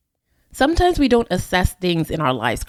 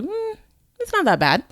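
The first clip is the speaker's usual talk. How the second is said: high hesitant hum, then a shrugging excuse; mock reluctant tolerance; 12 semitones higher than usual. Voicing the excuse we should say no to, mock reluctant tolerance. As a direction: make a half-hearted excuse.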